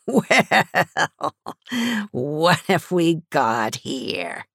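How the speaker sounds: high, laugh-filled taunt, then slow; laughing menace. A narrator's reading. Gang leader mocks the arriving cops, laughing. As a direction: taunt them through the laugh. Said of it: "laughed like a maniac".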